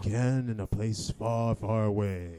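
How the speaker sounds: slow, ominous storyteller voice; drawn-out epic movie narration; sinister trailer voice. Acted. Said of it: "Oh, that's awesome".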